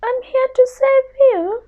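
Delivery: a tiny scared mouse begging pardon; little mouse voice. Acted. Mouse arrives to help.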